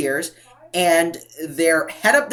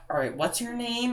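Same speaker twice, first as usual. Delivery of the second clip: soft, higher mumble; imitated mumbling; 6 semitones higher, 9 dB quieter than usual. Fake threat to staff while walking out, mumbled softly. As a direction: ask it half under your breath. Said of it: "I was mumbling".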